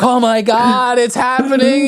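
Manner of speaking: high-pitched panicked wailing; hammed-up mortal terror; mock scared. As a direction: imitate a man terrified for his life. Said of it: "He's so scared".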